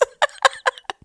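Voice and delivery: helpless laugh at your own guilty pleasure; real giggle; giggling. Real, not acted.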